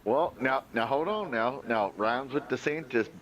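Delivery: ordinary talk, nothing marked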